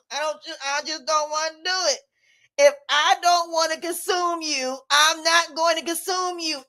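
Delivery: ordinary delivery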